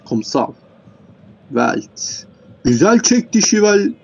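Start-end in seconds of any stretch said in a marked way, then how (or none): 2.6-3.9 raised, loud burst of commentary; excited play-by-play. Real, not acted. Commentator reacting to Şivel's shot, excited. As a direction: call the shot high and hard.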